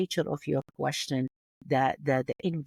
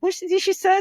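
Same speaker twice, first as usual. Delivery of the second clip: much higher, mock puzzled question; baffled listener; 14 semitones higher, 6 dB louder than usual. Imitating audience confused by her Kurdish melody, high baffled voice. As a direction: play a listener thrown by her accent. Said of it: "You be like".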